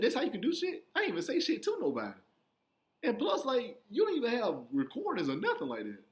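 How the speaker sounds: high-pitched, incredulous objection; indignant protest. Retold or imitated. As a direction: plead your case in outraged disbelief. Retold protest to the judge.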